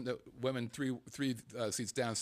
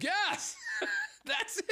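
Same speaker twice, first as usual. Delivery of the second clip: laughing judge; slower than usual. Retold or imitated. Judge relieved by sensible answer.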